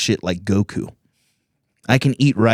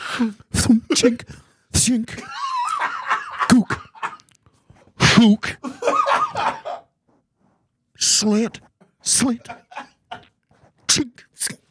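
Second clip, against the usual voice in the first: mock taunting; much slower than usual. Mimicking taunts hurled at him.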